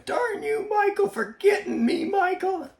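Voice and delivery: high, loud mock yell, laughing at the end; mock yell at the donkey. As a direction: playfully shout at the donkey like an exasperated wife. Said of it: "she might be yelling".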